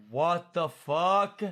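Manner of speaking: slow, drawn-out stunned disbelief. Retold or imitated. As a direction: let it sink in, slowly. Shocked at her explanation.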